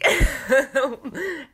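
a high, loud burst of laughter. Real, not acted. Laughing at the husbands' banter, a loud high laugh. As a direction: laugh out hard at the story.

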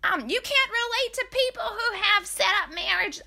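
mocking high-pitched persona voice; Drunk Jen. Podcaster mimicking a drunk reality star, mocking high voice. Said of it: "the persona Drunk Jen puts out has this voice".

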